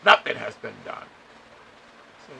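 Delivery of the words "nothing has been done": louder, exasperated imitation of the lady; mock her fed-up outburst; frustrated. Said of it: "frustrated as hell".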